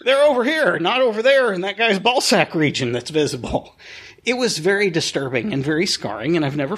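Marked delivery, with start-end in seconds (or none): 0.0-3.7 high-pitched, hammed-up fake casual chatter; forced nonchalance. Adults distracting each other from the sight, forced nonchalance. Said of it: "trying to very nonchalantly, like".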